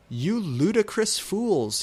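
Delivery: high, loud, slow mock rage; shouted. A narrator's reading. Dumbledore shouts at the two students, hammed-up anger. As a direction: spit out the insult in fury. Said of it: "he shouted".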